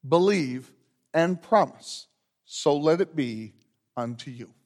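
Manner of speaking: measured, solemn liturgy voice; liturgical leading. Acted. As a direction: lead the congregation through the rite. Pastor leads confession and absolution liturgy.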